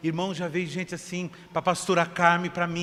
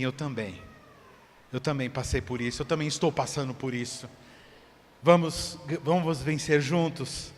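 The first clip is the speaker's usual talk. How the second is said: soft empathy; 6 semitones lower, 4 dB quieter than usual. Retold or imitated. Friend empathising softly.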